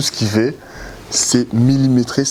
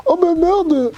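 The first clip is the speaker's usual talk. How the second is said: mock startled curse; slower than usual. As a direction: squeal it like she just dropped something. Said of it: "elle fait".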